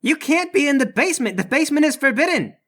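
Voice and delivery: high-pitched indignant guard voice. Acted. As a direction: scold intruders, aghast and flustered. Guard scolding intruders in basement.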